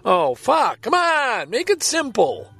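normal talking voice

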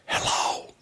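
breathy test of a voice that is gone; testing a lost voice. Real, not acted. Checking whether her voice works, with only a whisper. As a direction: try the voice and get only air. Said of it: "my voice is gone".

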